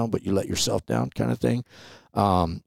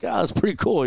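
put-on impressed kid; 12 semitones higher than usual. Young self impressed by gun.

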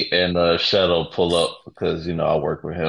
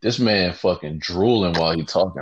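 usual speaking voice

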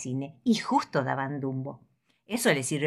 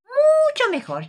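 delighted teacher; 8 dB louder, slower than usual. Acted. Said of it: "afirma el grillo".

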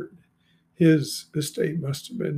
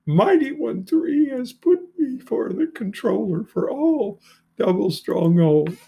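high, emotional reading, really choking up; overcome with emotion; 6 semitones higher, 3 dB louder than usual. Real, not acted. Reading Joseph's message to his father, overcome with emotion. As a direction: read it moved, pitch rising sharply. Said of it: "cannot help but get emotional".

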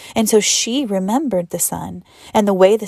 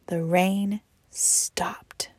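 slow hushed whisper; 7 dB quieter, much slower than usual. A narrator's reading. Narrator whispering as the rain stops. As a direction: let the silence fall, slowly.

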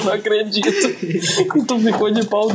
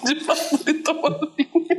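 higher, laughing repeat; stifled giggles; 6 semitones higher, 4 dB quieter than usual. Real, not acted. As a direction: giggle it back.

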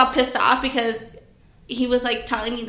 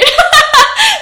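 loud high burst of laughter; real cackling laugh; 11 dB louder than usual. Real, not acted. Laughing at own acted panic.

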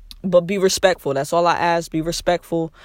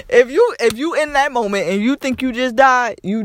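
high, loud laughing delivery; nervous laughing retelling; laughing while telling; 5 semitones higher, 4 dB louder than usual. Real, not acted. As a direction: laugh through the words, pitched up.

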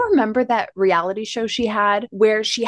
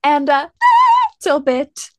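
imitated whistle tone; 6 semitones higher, 4 dB louder than usual. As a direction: pop up into a mock whistle note mid-phrase. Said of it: "brings in whistle tone".

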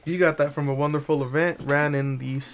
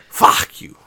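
voiceless loud curse; 10 dB louder than usual. Real, not acted. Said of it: "Just go for it".

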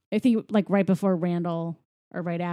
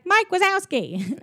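high-pitched imitated child; little kid voice; 10 semitones higher than usual. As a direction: call it out like an eager little kid. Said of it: "one of the little kids comes up".

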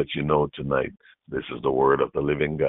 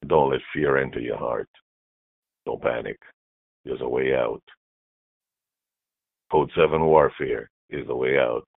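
normal talking voice

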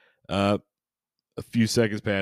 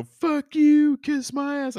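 very high-pitched mock yell, held out; loud, strained falsetto shout; 6 dB louder, slower than usual. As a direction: imitate a furious yell in a piercing high voice. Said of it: "you know, yelling".

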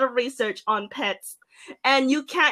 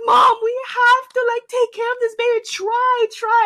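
high, pleading plea to mom; 11 semitones higher, 4 dB louder than usual. Retold or imitated. Begging mom to save the babies, high pleading. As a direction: beg high and urgent.

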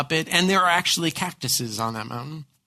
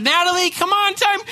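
high, loud call across the yard; singsong shout for a kid outside; 13 semitones higher, 8 dB louder than usual. Retold or imitated. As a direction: call the child in from the play set. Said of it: "I said".

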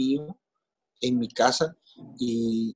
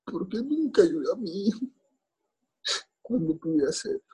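higher, strained voice fighting tears; voice breaking; 10 semitones higher than usual. Real, not acted. Father blaming himself for his son's death, voice breaking. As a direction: push the words out through grief after long silences.

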